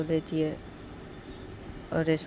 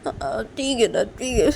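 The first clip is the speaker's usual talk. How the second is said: higher, choked-up giving in; acted weepy surrender; tearful compliance; 5 semitones higher, 6 dB louder, slower than usual. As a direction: agree while trying to stop crying. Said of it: "फिर से रो रही हो?".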